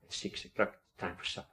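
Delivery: put-on whispered thought of a little girl; a girl quietly musing she should set the table; to herself. A narrator's reading. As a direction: breathe it to yourself like a child thinking aloud. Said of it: "the little girl says to herself".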